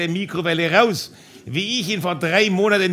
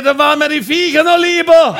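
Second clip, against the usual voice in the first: higher, much louder fed-up shout; neighbour yelling; 8 semitones higher, 8 dB louder than usual. Retold or imitated. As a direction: bellow it over the fence. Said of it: "Der Nachbar geschrien".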